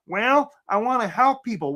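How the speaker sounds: goofy put-on simpleton; Gomer. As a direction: play the earnest, clueless job seeker. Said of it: "That's great, Gomer".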